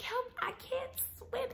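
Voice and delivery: high, slow mock wail; retold cry for help. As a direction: wail it high, imitating a drowning plea. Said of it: "So I'm like".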